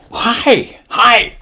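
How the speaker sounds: high mock-Japanese chorus; Japanese pilots' cry. Pilots answering their admiral, high mock-Japanese cry. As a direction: chime in like obedient recruits. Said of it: "all these guys are going".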